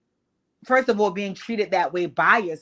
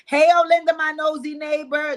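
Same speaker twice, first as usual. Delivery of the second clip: 1 mock call; 5 semitones higher, 5 dB louder than usual. Calling out, repeating the bit.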